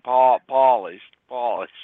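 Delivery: loud, drawn-out Brooklyn "polished"; Brooklyn accent. Shown as a demonstration. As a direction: say it like a Brooklyn native. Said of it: "you gotta say it like, like in Brooklyn".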